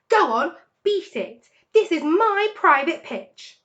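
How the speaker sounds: high loud slow shriek. A narrator's reading. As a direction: keep screeching the rat away. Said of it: "shrieked Rat".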